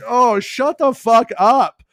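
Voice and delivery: high, loud, slow mock snap; mock exasperation. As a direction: tell the reporter off, fed up. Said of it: "I'm just like".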